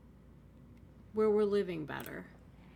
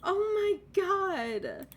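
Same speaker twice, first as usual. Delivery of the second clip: mock upset; 7 semitones higher, 4 dB louder, slower than usual. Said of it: "so upset right now, like, I could cry".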